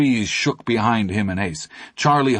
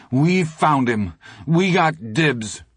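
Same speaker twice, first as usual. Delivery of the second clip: slow, a touch of tremble, higher; trembling, slow plea; 6 semitones higher, slower than usual. Acted. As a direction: hold ground though scared. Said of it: "in a trembling voice".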